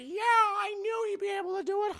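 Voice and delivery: high-pitched gleeful character voice; hammed-up delight, loud and high; excited. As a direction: cheer it in a put-on high voice. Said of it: "He's so excited".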